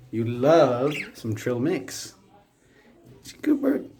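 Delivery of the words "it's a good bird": much higher, sweet praising voice. Real, not acted. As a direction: praise the bird in a high coo. Praising the budgie.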